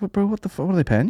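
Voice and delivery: high, disbelieving what the fuck; incredulous. Retold or imitated. Viewer gawping at a detective's house, high disbelief. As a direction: gasp at the detective's wealth.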